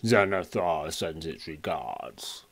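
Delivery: slow, deep orc growling out a message; deep voice with orcish accent. Acted. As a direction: play an orc, slow and low. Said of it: "in a deep voice with an orcish accent".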